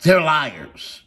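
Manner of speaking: loud, high and furious; angry shout; shouted accusation. Real, not acted. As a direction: yell it out in outrage. Repeated, much louder.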